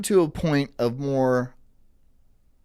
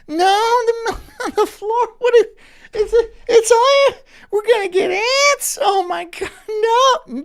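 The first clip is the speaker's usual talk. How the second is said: much higher, hammed-up whimpering panic; whiny meltdown; 6 dB louder than usual. Mocking someone wailing over spilled milk, much higher and whiny. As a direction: melt down over spilled milk like a big baby. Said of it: "what are you gonna sit there and be mad".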